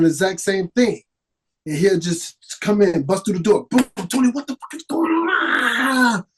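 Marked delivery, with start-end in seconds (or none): 3.7-6.2 high, strained mock scream; imitating screaming director. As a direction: scream it like a director bursting through the door. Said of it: "Screaming at him".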